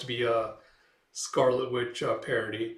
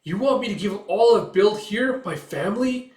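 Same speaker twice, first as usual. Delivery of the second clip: much higher, much louder mock cry; anguished protest; 9 semitones higher, 9 dB louder than usual. Witch protesting to the group, loud. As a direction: rage at losing everything.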